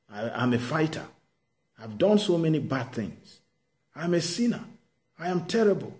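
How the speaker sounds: a pleading man begging God to excuse him; desperate plea. Acted. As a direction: cry out your unworthiness to God. Moses voiced desperate before God.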